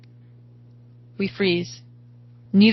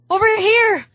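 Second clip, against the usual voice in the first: high, loud call for help; yelled; 13 semitones higher, 6 dB louder than usual. A narrator's reading. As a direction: yell to be found. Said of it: "I yell".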